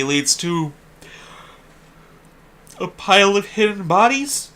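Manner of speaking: high, loud, rising question; unsure guess. Real, not acted. Player guessing where the stagnant path leads, unsure guess. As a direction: float the guess upward in doubt.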